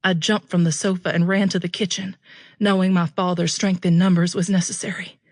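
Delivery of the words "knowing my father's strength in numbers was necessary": usual speaking voice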